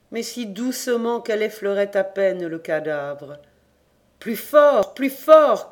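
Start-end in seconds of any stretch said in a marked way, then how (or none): 4.2-5.7 loud, urgent command; cried out. A narrator's reading. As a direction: shout it up from the grave. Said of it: "cria celui-ci". (crié fort et pressant)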